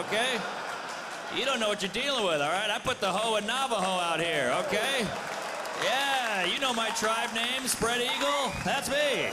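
mock boast. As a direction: brag it out, full of swagger. Bragging as promiscuous tribeswoman.

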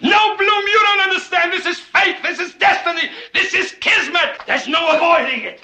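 very high, impassioned insistence; high-pitched theatrical fervor; fervent urging. Acted. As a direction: proclaim it like destiny calling. Urging reluctant accountant with fervor.

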